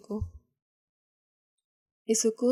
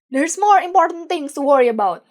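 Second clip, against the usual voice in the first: higher, loud shout; 5 semitones higher, 10 dB louder than usual. A narrator's reading. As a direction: shout it down the van. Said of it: "the leader shouts".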